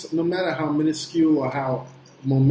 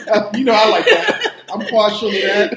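laughing; 5 semitones higher, 8 dB louder than usual. Real, not acted. Likes the episode title.

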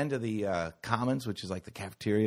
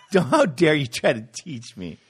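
louder, laughing outrage; entitled student rant; 4 semitones higher, 5 dB louder than usual. Pretend. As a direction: rail at the teacher like a spoiled brat.

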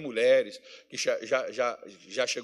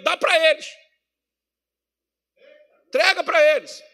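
loud, high fiery preaching; preaching at full force; 9 semitones higher, 7 dB louder than usual. Acted. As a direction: thunder it at the congregation. Pregação inflamada sobre o dízimo. (pregação inflamada, alta e aguda)

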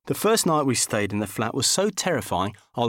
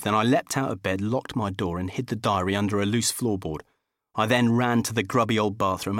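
ordinary delivery, nothing marked

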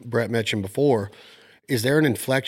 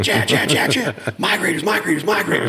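high, loud mimicked alarm chatter; funny excited voice; 5 semitones higher, 4 dB louder than usual. Storyteller mimicking his eagle-eyed hunting partner spotting migrators, funny voice. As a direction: imitate a buddy frantically spotting ducks, comically. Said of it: "in that funny voice".

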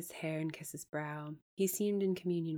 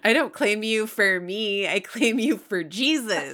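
very loud, higher mock proclamation; hammed-up zealot; 5 semitones higher, 11 dB louder than usual. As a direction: declaim it like an overblown missionary. Hammed-up missionary proposal, then laughter.

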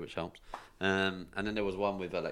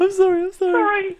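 very high, loud squeaky sorry; squeaky high apology; 13 dB louder than usual. Retold or imitated.

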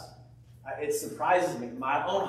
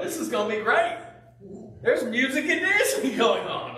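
excited guess; 8 semitones higher, 4 dB louder than usual. Retold or imitated. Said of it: "we would go".

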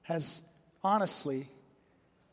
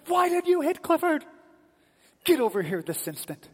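high, loud scolding, dropping to a stern order; angry mother yelling; 10 semitones higher, 6 dB louder than usual. Retold or imitated. Mom orders her crying son over, high angry yell settling into command. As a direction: imitate a mother losing her temper.

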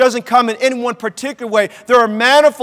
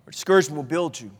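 low, soft, quick answer; hushed counterpoint; 8 semitones lower, 10 dB quieter, faster than usual. Real, not acted. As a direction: drop right down for the hopeful alternative.

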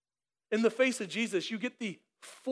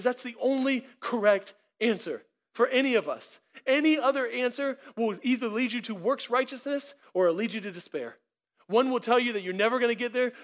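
raised, driving preacher's voice; preaching; 3 semitones higher, 4 dB louder than usual. Real, not acted. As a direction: press the point home to the congregation. Preaching at full force.

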